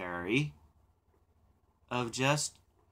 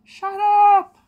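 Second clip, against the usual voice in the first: much higher, much louder mock scream; pretend shout; 16 dB louder, much slower than usual. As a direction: blow up at the chatter, hammed up. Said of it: "I'd be like".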